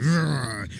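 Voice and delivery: drawn-out playful monster growl; mock monster roar. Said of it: "I play the monster".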